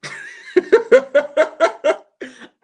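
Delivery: a real, loud cackle; loud laugh. Real, not acted. Cracking up at a viewer's mukbang joke, loud laugh. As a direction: burst out in a loud high laugh.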